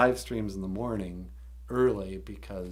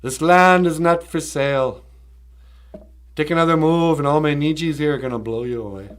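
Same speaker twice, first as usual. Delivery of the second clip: loud, higher, mock-angry declaration; hammed-up defiant warning at raised volume; Val Kilmer; 6 semitones higher, 9 dB louder than usual. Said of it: "And Val Kilmer was like".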